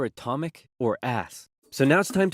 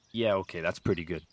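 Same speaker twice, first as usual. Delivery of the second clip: usual speaking voice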